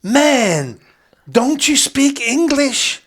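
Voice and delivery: loud, high, drawn-out mock outrage; exasperated stranger, imitated. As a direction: holler it slowly at a clueless foreigner. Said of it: "And he went".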